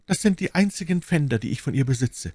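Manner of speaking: high, strained deathbed voice; dying duke, wistful. A narrator's reading. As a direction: show treasured keepsakes with failing strength. Dying duke shows keepsakes. (sterbender Herzog, wehmütig)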